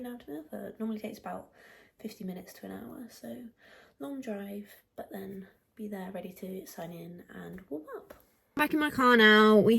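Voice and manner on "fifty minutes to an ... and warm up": whispering. Real, not acted. Whispering early morning.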